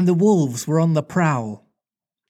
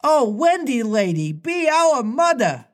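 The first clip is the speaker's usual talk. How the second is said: put-on boys crying out; 8 semitones higher than usual. Kneeling boys beg Wendy to mother them, high pleading.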